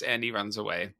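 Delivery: ordinary delivery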